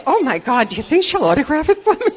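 thrilled fan begging for an autograph; starstruck excitement. Retold or imitated. Starstruck newcomer meeting Lois.

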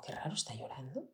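hushed whisper, puzzled; whispered to herself. Retold or imitated. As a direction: whisper so as not to wake anyone. Mother hears son crying at night. (susurro extrañado)

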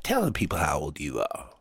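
soft hushed whisper. Acted. Whispered aside to Lulu.

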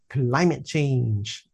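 slow, drawn-out whiny "Climate change"; whiny lament. Retold or imitated. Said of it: "they all sound like that".

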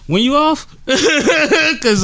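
high mocking voice through laughter; laughing.

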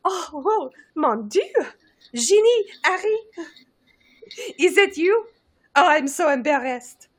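high, breathy French lady voice; French accent. Acted. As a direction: play Fleur with a thick French accent. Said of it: "She said, she said".

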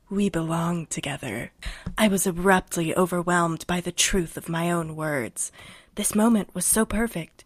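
hammed-up seductive read-aloud, lightly done; sexy podcast voice. Host reading the book summary to listeners, mock-sultry low voice. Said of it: "I'm gonna read it in my sexy podcast voice".

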